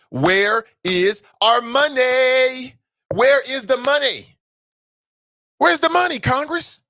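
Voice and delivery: high, drawn-out cry of where is our money; rising demand. Real, not acted. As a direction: call it out like a rallying chant. Radio host demanding stimulus money.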